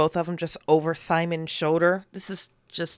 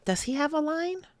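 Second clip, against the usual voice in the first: soft high voice; 12 semitones higher, 3 dB quieter than usual. Acted. Emily's soft concerned question.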